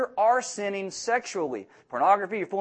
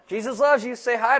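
laughing joke; 5 semitones higher, 4 dB louder than usual. Real, not acted. Joking after running man off.

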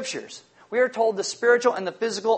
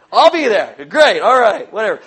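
louder, keen agreement; loud eager reply; 10 dB louder than usual. Retold or imitated. Guests shouting yes to the party, loud and keen.

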